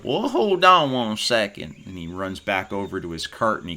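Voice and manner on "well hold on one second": a hint of the hearty farmer, a bit higher; lightly voiced farmer stopping them; barley farmer. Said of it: "he goes".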